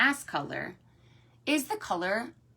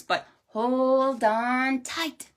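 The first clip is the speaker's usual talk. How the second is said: slow, stretched, higher story-horse voice; drawn-out warning; 4 semitones higher, 4 dB louder, much slower than usual. Acted. Mister Horse warning riders on the sleigh, slow drawn-out words. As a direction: stretch each word out for suspense.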